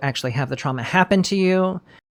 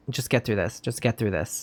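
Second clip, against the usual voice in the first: low, much softer retold inner voice; quiet self-talk; 6 semitones lower, 10 dB quieter than usual. Host voicing the wife telling herself to endure, hushed self-talk. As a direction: murmur it inwardly, like getting through it. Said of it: "she's just telling herself".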